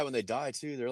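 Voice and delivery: usual speaking voice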